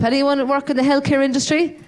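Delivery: usual speaking voice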